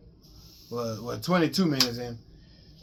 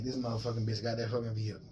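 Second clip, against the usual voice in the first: muttered curse; 7 semitones lower, 14 dB quieter than usual. Retold or imitated. Furious muttered aside.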